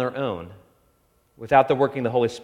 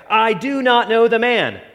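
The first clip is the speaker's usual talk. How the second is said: higher, louder cursing denial; sworn denial; 8 semitones higher, 5 dB louder than usual. A narrator's reading. Peter swearing he never knew Jesus, raised and desperate. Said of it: "began to invoke a curse on himself and to swear".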